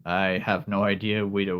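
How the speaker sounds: usual speaking voice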